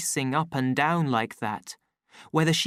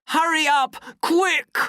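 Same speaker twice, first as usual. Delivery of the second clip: angry shout; 6 dB louder than usual. A narrator's reading. Savage driving her out.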